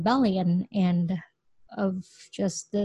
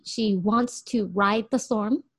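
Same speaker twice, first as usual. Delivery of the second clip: ordinary delivery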